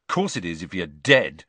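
low, irritable retort; snapped. A narrator's reading. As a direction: mildly snap back at a scolding. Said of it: "snapped Ron".

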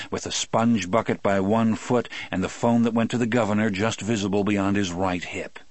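ordinary delivery

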